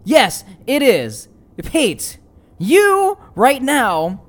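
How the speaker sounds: slow, loud, high, forceful scolding; commanding angry shout, drawn-out key words; furious dressing-down. Acted. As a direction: hammer each phrase at a wavering ally. Emerald berates Pate face to face.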